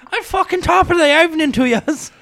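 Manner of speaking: high, loud mock Irish brogue; hammed-up Irish accent. Stage Irish greeting.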